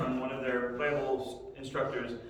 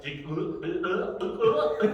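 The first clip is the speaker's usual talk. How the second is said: loud gulping; 3 semitones higher, 4 dB louder, much faster than usual. Retold or imitated. Kid gulps water loudly to annoy his sister. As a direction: gulp water as loudly as possible. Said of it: "they're drinking water, like".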